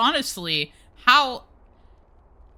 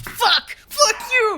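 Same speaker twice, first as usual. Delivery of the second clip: furious outburst; 12 semitones higher, much slower than usual. Retold or imitated. Cursing the game for the death, a high yell. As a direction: scream it at the game. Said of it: "it's like".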